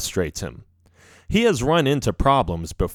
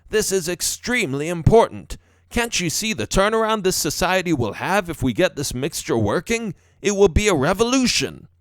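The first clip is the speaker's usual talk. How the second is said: higher, insistent professor voice; earnest professor; 7 semitones higher than usual. A narrator's reading. Professor defending his overtime to his wife, earnest. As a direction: plead the cause, pitched up.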